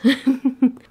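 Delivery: high, loud quick giggle. Real, not acted. Laughing at her own "babes" greeting, high giggle. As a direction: burst of rapid laughter.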